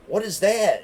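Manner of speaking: high-pitched mock scolding; imitated yelling. Wife confronting him about cars he brought home, mock yelling. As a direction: imitate a wife's yelled question, pitch jumping up. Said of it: "she'd be yelling out".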